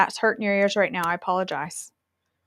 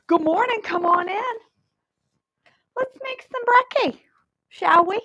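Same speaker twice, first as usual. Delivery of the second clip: high, bright hostess voice; chirpy morning-show welcome; sing-song greeting; 12 semitones higher, 4 dB louder than usual. Acted. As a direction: greet them like a cheery TV host. Cheery sing-song greeting to viewers.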